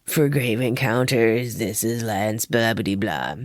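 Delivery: Lance signing off. Retold or imitated. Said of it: "he's like".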